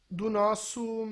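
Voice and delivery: ordinary delivery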